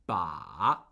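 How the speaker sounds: Ba dipping very low, then rising; third tone on Ba. Shown as a demonstration. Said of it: "The falling and rising tone".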